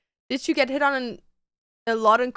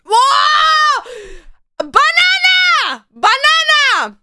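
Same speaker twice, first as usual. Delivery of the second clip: excited shout; 10 semitones higher, 3 dB louder, much slower than usual. Real, not acted.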